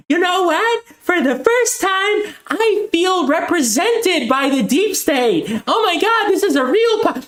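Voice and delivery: high mock-gushing voice, slowed; mocking populist. To viewers, mocking populists who cheer for war, in a high gushing parody voice. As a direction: parody a dazzled fan of the state. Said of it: "these dumb fuck populists are gonna be like".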